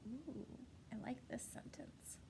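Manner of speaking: soft secretive whisper. Acted. Teacher confides favorite sentence.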